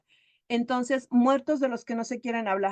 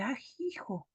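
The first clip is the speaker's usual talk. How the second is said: very soft acted gasp of disbelief; hushed astonishment; 12 dB quieter, slower than usual. Son seeing the glass fog over, hushed. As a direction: say it under your breath. Hijo asombrado ante la caja.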